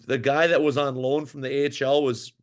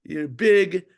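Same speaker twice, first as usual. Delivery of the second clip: put-on heavy French Canadian accent, pitched higher; 5 semitones higher than usual. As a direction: imitate the Quebec coach with a thick French Canadian accent. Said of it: "in this heavy French Canadian accent".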